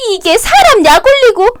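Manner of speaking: high, indignant cry; a wife's high, offended outburst; shrieking outrage. Acted. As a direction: rise up in outrage at her. Wife provoked by mocking reply.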